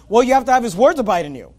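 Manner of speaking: ordinary talk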